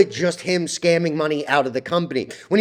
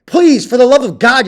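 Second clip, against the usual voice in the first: loud, higher, pleading to stop; fed-up shout at the bag holders; real frustration boiling over; exasperated outburst; 6 semitones higher, 7 dB louder, slower than usual. Real, not acted. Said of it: "you're gonna make me angry".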